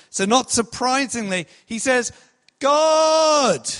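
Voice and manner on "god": mock cry to God. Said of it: "He cried out to the Lord".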